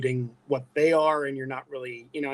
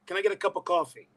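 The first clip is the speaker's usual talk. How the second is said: Brooklyn accent slipping out, higher; higher-pitched Brooklyn coffee order; tired Brooklyn accent; 4 semitones higher than usual. Shown as a demonstration. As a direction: let Brooklyn take over "coffee". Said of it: "it'll come out like".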